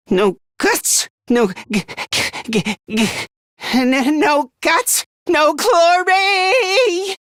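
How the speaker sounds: stammering, building to a high, drawn-out cry; terrified battle cry. Acted. Frightened boy powers through fear with his motto, stammering into a desperate cry. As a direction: choke on the words, then force them out.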